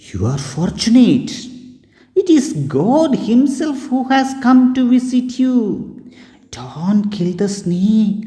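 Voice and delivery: put-on high, joyful old woman's voice; happy old beggar woman. A narrator's reading. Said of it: "the old woman became happy".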